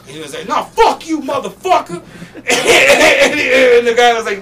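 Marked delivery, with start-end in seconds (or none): 0.5-2.0 high furious shout; angry shout. Retold or imitated. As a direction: bark it back at him. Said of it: "he's like so mad".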